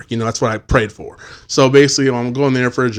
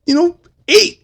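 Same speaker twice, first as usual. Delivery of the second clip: high-pitched imitation of a kid; mock little fan, pitched way up and loud; young kid; 10 semitones higher, 4 dB louder than usual. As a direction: pipe up like an excited child. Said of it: "some young kids coming up".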